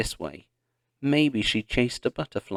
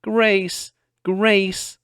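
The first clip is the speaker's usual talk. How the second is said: high, loud calling across the prairie; 10 semitones higher, 6 dB louder than usual. A narrator's reading. Pa calling for lost Grace, loud and high. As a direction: call her name far off.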